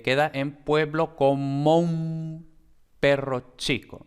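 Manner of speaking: como un run together slowly as comoum in one syllable; slow the line and glide como into un; slow linked reading. Shown as a demonstration. Teacher showing the sinalefa slowly to students. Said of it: "Entonces, eh".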